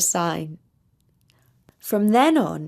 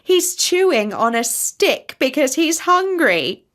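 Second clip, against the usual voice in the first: higher, mocking goose voice; lightly voiced jeering goose; joking goose; 6 semitones higher, 3 dB louder than usual. A narrator's reading. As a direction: tease the tortoise. Goose jokes about the tortoise.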